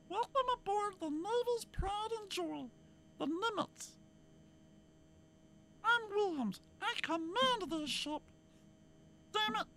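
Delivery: very high falsetto captain; captain's welcome in a silly falsetto. Acted. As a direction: squeak out the ship captain's welcome. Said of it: "He just had a high voice".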